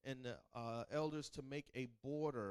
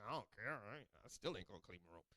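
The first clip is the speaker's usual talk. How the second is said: put-on indifferent kid; 8 semitones lower, 9 dB quieter, much faster than usual. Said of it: "then they'll be like".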